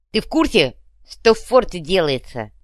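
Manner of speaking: ordinary delivery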